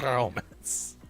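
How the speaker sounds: dolphin squeak with a trilled R in Romance; dolphin rolling R's. Acted. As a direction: roll the R like a dolphin. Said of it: "a dolphin rolling his R's".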